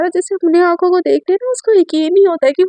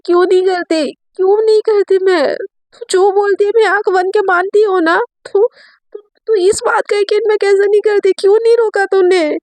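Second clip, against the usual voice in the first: higher-pitched, insistent accusing outburst; acted indignant protest, pitched up; upset reproach; 6 semitones higher, 4 dB louder than usual. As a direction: throw the questions back, hurt and heated. Acted reproach in an emotional quarrel.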